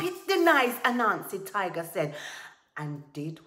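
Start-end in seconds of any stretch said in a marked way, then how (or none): 0.0-0.8 high, very loud interruption; impatient outburst. Acted. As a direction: cut him off loudly in exasperation. Tiger snaps back, impatient.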